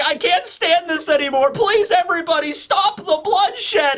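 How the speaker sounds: high-pitched mock pleading; desperate plea. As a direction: imitate a young hero begging everyone. Acts Kobe pleading for battle to stop.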